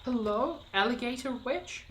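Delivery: game character calling out. Acted. Voicing timid caller in game.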